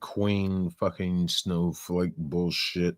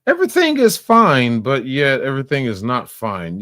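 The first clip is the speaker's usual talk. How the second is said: mocking imitation; 5 semitones higher, 7 dB louder than usual. Mocking her contradiction.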